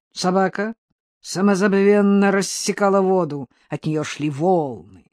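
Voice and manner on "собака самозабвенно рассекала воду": ordinary delivery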